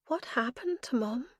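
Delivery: words catch in a gulp at the end; a mild choked-up question; choking gulp. Acted. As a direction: swallow the last word back, close to tears. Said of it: "came out as a choking gulp".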